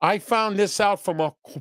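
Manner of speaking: normal talking voice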